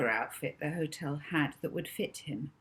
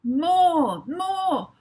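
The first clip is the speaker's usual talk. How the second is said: loud, much higher shouted demand; boy shouting at the TV for more; 12 semitones higher, 10 dB louder than usual. Acted. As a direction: yell it at the telly, wanting more. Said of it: "Rocky shouted at the T V".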